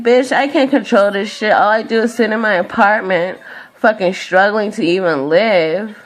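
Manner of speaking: ordinary talk, nothing marked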